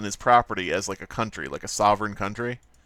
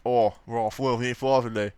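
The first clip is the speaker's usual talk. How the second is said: put-on Australian accent. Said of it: "that's how Australians talk".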